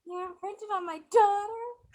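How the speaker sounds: high, slow, crying wail; banshee wail. Pretend. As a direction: sob it out like a grieving banshee. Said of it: "the Banshee yell that I would do".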